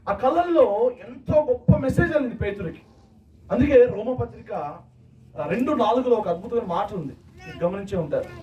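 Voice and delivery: normal talking voice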